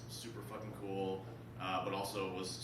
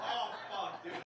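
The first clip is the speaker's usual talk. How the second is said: laughing exclaim; 11 semitones higher, 6 dB louder, slower than usual. Retold or imitated. Her own excited reaction.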